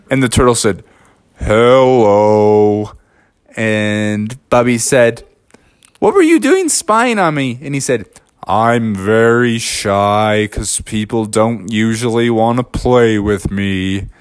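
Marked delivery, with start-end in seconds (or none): 1.4-2.9 low, drawn-out turtle hello; a shy turtle greets; slow turtle. Acted. 6.0-7.6 high, quick bear voice; the bear questions the turtle; bear asking. Acted. 8.5-14.1 low, slow, shy turtle voice. Acted.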